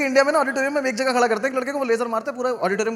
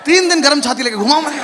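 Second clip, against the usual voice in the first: louder, higher exasperated yell with laughter; comic outraged shout; 4 semitones higher, 9 dB louder than usual. Acted. Comic ranting at the crowd about Vicks on his chest, shouting. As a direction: blow up at the parents' Vicks.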